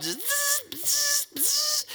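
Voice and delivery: very high repeated zzz whizzes; firework whizzes. Retold or imitated. Lining up drilled fireworks, high whizzing sounds. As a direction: whizz like rockets going up.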